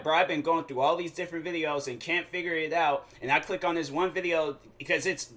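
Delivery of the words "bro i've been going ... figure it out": normal talking voice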